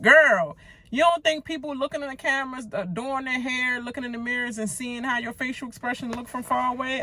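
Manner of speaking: shrieking at the TV in disbelief; incredulous rant. Real, not acted. Scolding houseguest on screen.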